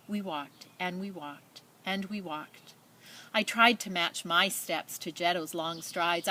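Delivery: story narration. A narrator's reading. Teacher reading a refugee story to children, earnest storytelling. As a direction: read it to the class like a moving tale. Reads picture book aloud.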